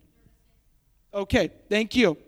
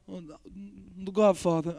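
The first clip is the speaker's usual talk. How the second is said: lightly attempted low, soft mob boss voice; half-hearted, hushed mafia boss impression; Godfather; 4 semitones lower, 6 dB quieter than usual. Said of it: "do a better Godfather impersonation".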